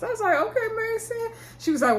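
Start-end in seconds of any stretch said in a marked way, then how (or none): 0.4-1.3 mock indulgent reply. Answering the kid on screen.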